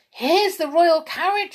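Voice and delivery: loud, high excited call; spotting the carriage and calling out; loud high sudden call; sudden shout. A narrator's reading. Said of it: "shouted Hop suddenly".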